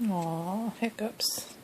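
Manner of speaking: soft whisper. Real, not acted. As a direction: hush it tenderly. Whispering to baby.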